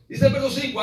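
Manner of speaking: ordinary delivery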